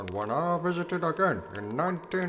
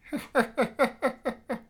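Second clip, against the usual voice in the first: mocking laugh; 6 semitones higher than usual. [name]'s parting joke.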